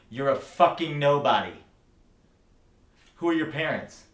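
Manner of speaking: contemptuous outburst; angry belittling. Acted. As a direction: hurl it at them, high and loud. Belittling the listener in acted quarrel.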